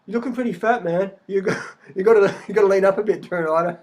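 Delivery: mocking commenters telling Ryder to lean up; mock critic. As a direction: jeer at him through a laugh. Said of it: "I've got anorexics out there going".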